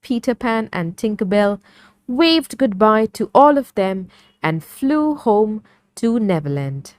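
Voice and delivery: usual speaking voice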